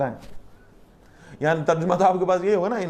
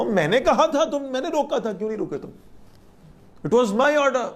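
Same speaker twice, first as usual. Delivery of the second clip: stern rebuke; 8 semitones higher than usual. Acted. God rebuking Adam and Eve.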